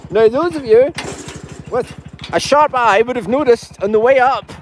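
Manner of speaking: excited host. Acted. High-pitched live show greeting viewers.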